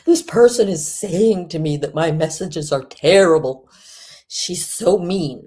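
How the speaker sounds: mocking the woman who calls her friends mean; mock whiny complaint. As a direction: whine it like a wounded victim.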